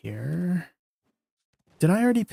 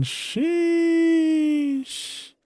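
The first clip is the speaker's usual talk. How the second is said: excited sheesh. Real, not acted. Excited as the gear starts glowing.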